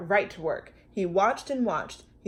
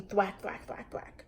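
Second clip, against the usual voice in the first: whispered; 9 dB quieter than usual. A narrator's reading. Fading digging sound.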